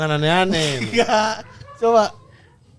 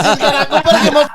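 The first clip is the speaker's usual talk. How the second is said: laughing; 5 semitones higher, 4 dB louder than usual. Retold or imitated. Mocking the actor's line.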